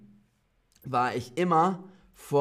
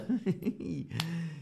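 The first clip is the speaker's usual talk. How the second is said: soft amused giggle; laugh; 3 semitones higher, 7 dB quieter than usual. Real, not acted. Teacher giggles after her own happy whoop. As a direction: giggle quietly after the cheer.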